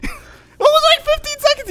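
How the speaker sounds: laughing up high. Real, not acted. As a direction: squeal it through a laugh.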